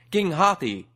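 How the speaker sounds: loud, high call; shouting. A narrator's reading. Kargash shouting up to the elephant king from a rock. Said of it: "Kargash shouted as loudly as he could".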